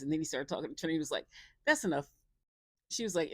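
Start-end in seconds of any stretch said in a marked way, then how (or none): 1.7-2.1 mock firm cutoff. Trinity cutting him off.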